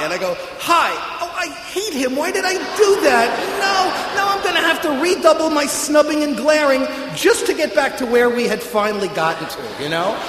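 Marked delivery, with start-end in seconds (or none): 0.6-0.9 high, loud, too-bright greeting; a hammed-up cheery hi; loud overeager hi. His overeager hi back. 1.2-9.6 high, loud self-scolding; a hammed-up panicked thought; frantic inner panic.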